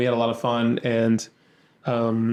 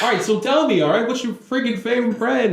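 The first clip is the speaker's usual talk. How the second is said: put-on higher, brash New York accent; 9 semitones higher than usual. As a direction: push it like a pushy New Yorker. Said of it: "this accent too".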